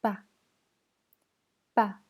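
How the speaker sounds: "pas" short and unstressed; neutral tone. Shown as a demonstration. Teacher drilling the syllable by tone, "pas" short and neutral. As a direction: say "pas" briefly, with no held glide. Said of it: "Et le ton neutre".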